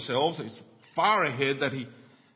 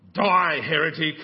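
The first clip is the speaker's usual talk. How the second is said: louder, slow comic shout; mock yell; 7 dB louder than usual. Stranger shouting at the jumper before pushing him, mock yell. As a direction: hurl it as a mock condemnation. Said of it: "he yelled at him".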